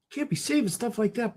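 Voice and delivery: high, soft put-on voice; quiet high-pitched mock nag; scolding heckler. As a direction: chide him in a small high voice. Imagined heckler scolds Baltazar.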